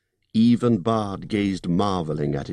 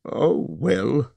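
uncomfortable; 7 semitones higher than usual. Acted. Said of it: "said the hobbit uncomfortably".

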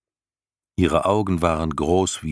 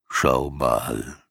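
put-on old turtle voice; 5 semitones lower than usual. Morla speaks to herself.

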